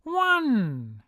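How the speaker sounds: high, loud drawn-out count; yell. A narrator's reading. Satan counts as he whips, loud yell. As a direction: call the lash out loudly. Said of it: "raises his whip and yells".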